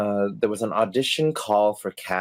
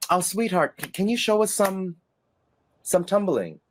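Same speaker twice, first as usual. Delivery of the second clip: higher, coaxing director voice; sweet director; 7 semitones higher than usual. Retold or imitated. Casting director sweetly coaxing the dancer to tumble, higher.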